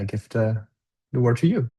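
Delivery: usual speaking voice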